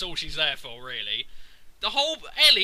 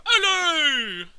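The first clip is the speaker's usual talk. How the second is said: higher and a bit louder, hammed-up hello; mock Isaac hello; 9 semitones higher, 5 dB louder than usual. Mocking Isaac's constant calls, a hammed-up hello. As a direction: sing out hello like the hero again. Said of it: "hear him go".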